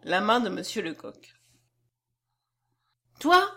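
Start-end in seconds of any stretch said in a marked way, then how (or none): 3.2-3.5 put-on high, louder outburst from a child; crying out. A narrator's reading. Little girl pushing away Lecoq's hand, crying out. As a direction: exclaim it at the one you detest. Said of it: "s'écria-t-elle".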